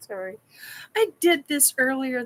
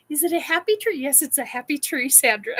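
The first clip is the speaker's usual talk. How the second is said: usual speaking voice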